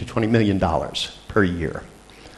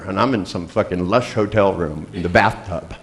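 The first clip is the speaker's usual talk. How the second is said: usual speaking voice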